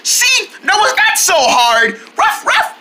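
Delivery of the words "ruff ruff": high pretend woof; playful dog bark. Voicing the dog who is glad it's over, high playful barks. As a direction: yap like a little dog.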